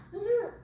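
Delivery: squeaky high playful boop; a tiny high squeak of a boop. Acted. Playing with the toddler.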